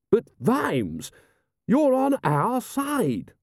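high, drawn-out lordly plea; affronted aristocrat protesting; aghast protest. Acted. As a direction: stretch it out high in disbelief. Rust protesting to Vimes.

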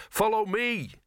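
high, drawn-out urgent order; urgent hissed command. A narrator's reading. Dog urging another dog to follow, urgent hiss. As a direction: call the dog on, pitched well up. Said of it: "hissed Gaspode".

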